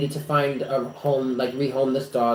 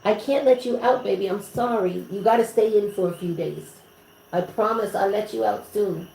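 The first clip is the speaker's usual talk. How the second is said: high, cooing apology; pet-talk voice; 6 semitones higher than usual. Real, not acted. Apologizing to a pet who can't come out, high cooing pet-talk. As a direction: sweet-talk a pet in a raised voice.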